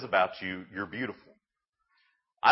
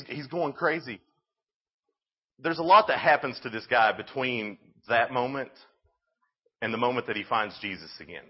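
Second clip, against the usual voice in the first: usual speaking voice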